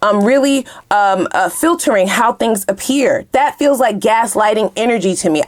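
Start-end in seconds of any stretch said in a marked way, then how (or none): none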